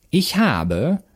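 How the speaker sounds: high, imperious witch voice; Baba Yaga commanding. A narrator's reading. Baba Yaga continues her orders, commanding. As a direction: open the order high and swooping. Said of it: "befahl die Baba Yaga weiter".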